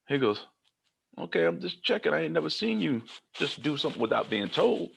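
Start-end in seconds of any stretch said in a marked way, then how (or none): 1.2-4.9 lightly voiced director.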